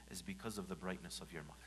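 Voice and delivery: soft breathy whisper, fast. Real, not acted. Preacher whispering about the mother's light. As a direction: whisper it tenderly.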